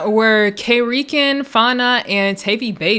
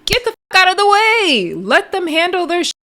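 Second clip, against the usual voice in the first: high, raised exasperated yell; calling out to security; 9 semitones higher than usual. Acted. Mock yelling at security.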